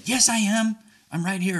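high-pitched indignant retort; defensive. Acted. Speaker insists they are listening, defensively. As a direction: protest the accusation at once. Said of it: "But don't go there".

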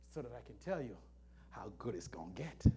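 soft, quick whisper. Acted. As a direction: whisper the promise. Whispered promise.

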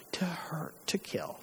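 grave, hushed and falling; hushed gravity. Acted. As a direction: let each phrase sink away quietly. Mother recounts the death sentence.

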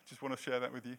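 usual speaking voice

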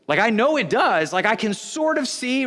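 ordinary talk, nothing marked

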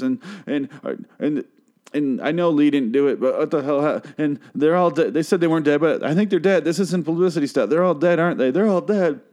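high-pitched mock emotional rambling; hammed-up distress over a TV show; pouring out emotions. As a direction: imitate an overwrought girl venting to her webcam. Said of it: "pouring out emotions over a T V show".

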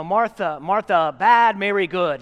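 loud, blunt caveman summary; mocking summary. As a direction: spell it out like a slogan. Caricature of simple sermon.

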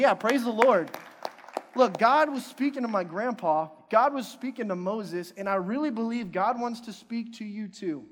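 loud, high fervent preaching. Real, not acted. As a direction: lift them up with fire. Preacher rising to fervent climax.

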